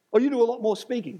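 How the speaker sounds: mock objection. Host objecting to the preacher's fifty-fifty claim, mock protest. Acts out his host's objection.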